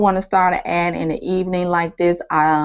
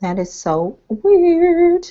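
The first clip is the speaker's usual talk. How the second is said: puzzled surprise; 11 semitones higher, much slower than usual. Real, not acted. Puzzled by ad settings.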